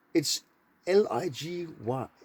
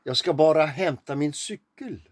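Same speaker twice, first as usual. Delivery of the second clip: Swedish sentence. Shown as a demonstration. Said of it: "And in Swedish".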